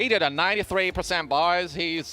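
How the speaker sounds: put-on hammy Australian accent. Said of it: "Is that your Australian accent?".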